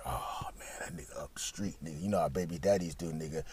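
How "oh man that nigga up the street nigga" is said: whispering. Retold or imitated. Whispering as baby daddy arrives.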